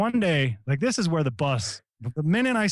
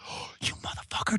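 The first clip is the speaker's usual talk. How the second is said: cursing under breath; 8 dB quieter than usual. Retold or imitated. Angry at man leaving.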